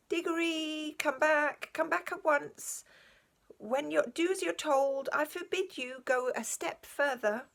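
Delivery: calling out but afraid to be heard; half-hearted shout. A narrator's reading. Uncle Andrew calling Diggory back from afar, timid shout. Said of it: "shouting, by no means at the top of his voice".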